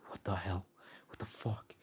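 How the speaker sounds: whispered, shocked curse; stunned whisper. Acted. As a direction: breathe it out in disbelief. Stunned henchman reacts in story.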